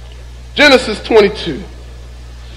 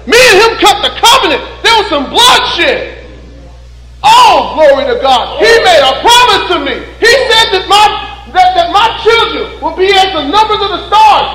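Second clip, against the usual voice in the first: confident Abraham; 9 semitones higher than usual. Retold or imitated. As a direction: preach Abraham's certainty at the top of your voice.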